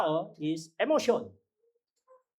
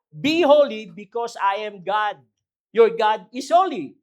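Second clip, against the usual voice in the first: proclaimed scripture; 3 semitones higher, 7 dB louder, slower than usual. Acted. Preacher proclaims scripture quote.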